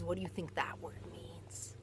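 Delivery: hushed whispered question. Real, not acted. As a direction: lean in and whisper it. Teacher aside to child.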